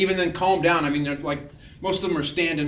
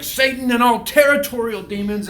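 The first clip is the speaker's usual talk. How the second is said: high, loud proclamation; mock deliverance invocation; 7 semitones higher, 8 dB louder than usual. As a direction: summon them like an exorcist. Re-enacts binding incantation.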